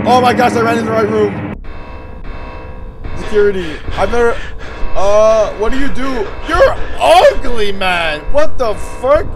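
loud, high frantic babbling and yelling; panicked. Real, not acted. Player facing an ugly monster, panicked yelling. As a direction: blurt it out in real panic.

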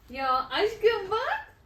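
high, loud and drawn out; excited call. Real, not acted. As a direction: stretch it out, eager to show. Calling husband to look at item.

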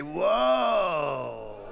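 loud drawn-out whoa; excited crowd whoa. Acted. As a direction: marvel like an eager crowd.

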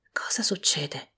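sussurrato, lento e piano; sussurro. A narrator's reading. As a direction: chiede sottovoce, con delicatezza. Lo spirito domanda piano. (sussurro lento dello spirito)

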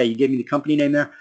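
ordinary delivery

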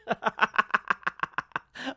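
high staged laughing fit; hammed-up cackle. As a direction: cackle in character, high and long. Acted laugh after gloating.

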